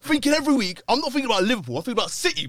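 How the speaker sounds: high, loud, worked-up rant; heated rant. Real, not acted. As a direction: vent it like a stressed fan. Heated rant about City pressure.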